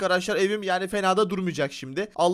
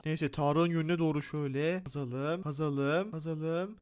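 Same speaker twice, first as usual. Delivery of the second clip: sneaky hushed; 4 semitones lower, 8 dB quieter, slower than usual. Pretend. Sneaking under friend's house.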